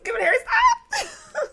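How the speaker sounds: playful yelp at a friend; laughing shout. Real, not acted. Teasing a friend who grabs it, laughing shout.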